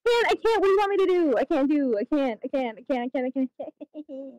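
high, frantic then fading repeats; frantic panic fading out; panicked. Real, not acted. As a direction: pile up the words in rising panic, then trail off. Panicking under attack in game.

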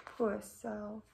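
whispered pity. Real, not acted. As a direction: breathe it out softly, all air. Sympathetic aside to class.

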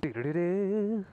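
usual speaking voice

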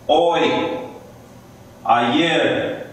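ordinary talk, nothing marked

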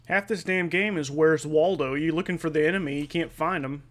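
normal talking voice

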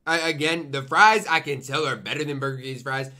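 ordinary delivery

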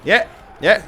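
loud, high no no; panicked shout. Real, not acted. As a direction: yelp it in panic. Panicked refusal. (głośny, spanikowany krzyk)

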